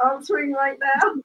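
normal talking voice

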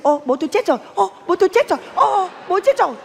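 high, gleeful imitation; mock elation. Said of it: "Mắt mày cứ sáng rực".

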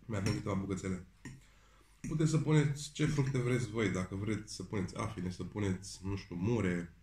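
chewing while talking; talking between bites; mouth full of food. Real, not acted. Seen 2: Fork to mouth at -1.0; eats and talks over food throughout.

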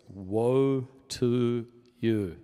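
slow solemn lament; drawn-out woe. Acted. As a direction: stretch the word Woe out heavily. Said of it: "imagine now God critiquing how you do your religion".

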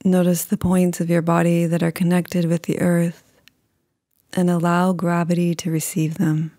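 calm, unhurried meditation voice with long pauses; guided meditation. Acted.

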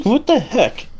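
mock bafflement, voice lifted high; baffled guard. Guard baffled by the kid's remark.

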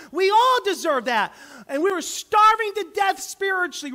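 loud, emphatic preaching on all. Acted. Preacher to congregation, loud emphatic sermon. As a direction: pound each all like a pulpit call.

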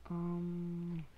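normal talking voice

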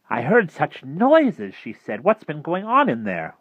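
high, loud, swooping voice; concerned woman. Acted. Mrs. Griffin on the lawn asking Harvey, concerned. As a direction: draw it out in a lifted voice.

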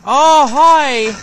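high, loud squeal of hello; mock cheery greeting. Gamer hails an enemy with a loud squealed hello.

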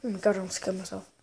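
usual speaking voice

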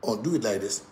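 soft, low retold fatherly advice; father giving guidance. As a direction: quote a father quietly guiding his child. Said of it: "my father will just give me guidelines".

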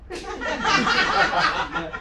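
high breathy laugh; amused laughing; laughter. Real, not acted. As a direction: burst out laughing. Laugh after poem line.

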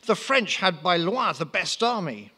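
light comic Frenchman; French accent. Acted. Napoleon's French voice.

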